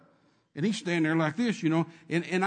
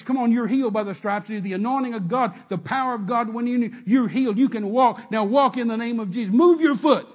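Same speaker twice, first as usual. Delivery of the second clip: loud, higher insistent urging; 4 semitones higher, 8 dB louder than usual. Retold or imitated. Preacher in the man's face urging him, loud. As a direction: press him hard to move.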